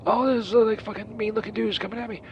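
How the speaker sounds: ordinary talk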